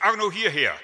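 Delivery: loud, high call to a dog; commanding a poodle to come, loud and high. Retold or imitated. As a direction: shout the dog's name across the yard, loud and high. Someone calling a dog again.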